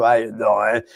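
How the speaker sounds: low, mildly put-on gavone greeting. Mocking a jerk off's greeting, lightly put-on gavone voice. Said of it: "like a gavon".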